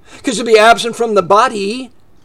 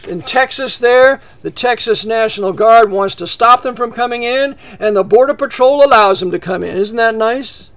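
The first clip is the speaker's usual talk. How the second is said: ordinary talk, nothing marked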